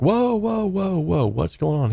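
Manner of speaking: high startled yelps, fading down; panicked whoa. Retold or imitated. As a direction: recoil in alarm, hands up. Shotgun fired next to him.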